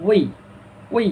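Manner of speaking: "ওই" gliding from rounded o to released i; round then release. Shown as a demonstration. Teacher demonstrates the diphthong, rounded-then-released "ওই". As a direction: round the lips for "ওই", then let them spread. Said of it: "মুখটাকে প্রথমে গোল করে পরে ছাড়ে দিব".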